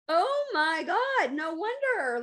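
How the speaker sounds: high, drawn-out "oh my God" moment; sudden realization. Retold or imitated. Speaker retelling her revelation about guilt and drinking, astonished realization.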